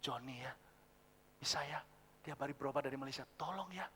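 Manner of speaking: very low, hushed, halting request; reluctant father. Acted. Father phoning to ask a favour for a relative, reluctant and hushed. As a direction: ask softly with pauses, shy to impose. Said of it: "nadanya sungkan".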